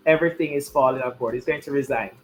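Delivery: normal talking voice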